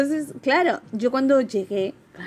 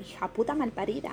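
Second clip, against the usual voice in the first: Colombian banter; 8 dB quieter, faster than usual. Retold or imitated. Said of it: "siempre estamos hablando".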